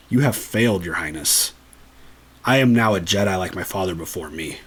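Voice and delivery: solemn Luke. Retold or imitated. Luke defies Palpatine, low and solemn. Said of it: "Tells Palpatine".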